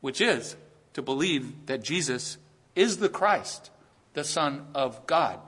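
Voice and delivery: "the Christ" and "God" slow and weighty; slow emphasis. Shown as a demonstration. Pastor showing the real emphasis, slow and deliberate. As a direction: dwell slowly on "Jesus is the Christ, the Son of God". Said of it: "miss the actual emphasis".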